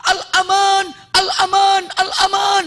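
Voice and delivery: high, loud drawn-out calls; shouting. Acted. Suraqah calls to Muhammad for safety, acted shouting. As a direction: cry out for safe passage across a distance. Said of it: "sekarang gayanya sambil teriak".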